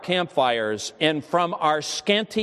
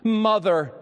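a single word pushed out higher, with weight; deep full emphasis; 6 semitones higher than usual. Retold or imitated. Dying boy calling for his mother, with deep emphasis. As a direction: cry it out like a dying boy's plea. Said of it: "burst a single word with deep, full emphasis".

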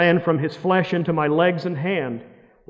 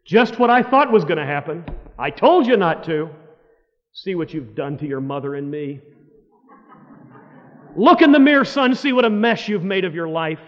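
retold father shouting at his son, louder and higher; imitating angry father; 3 semitones higher, 6 dB louder than usual. Father yelling at prodigal son in mock fury. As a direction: blast it like a furious dad.

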